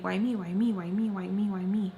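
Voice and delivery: loud, insistent repeated lament; desperate refrain. Retold or imitated. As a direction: repeat it like a thought looping in your head. Voicing desperate thoughts in a loop.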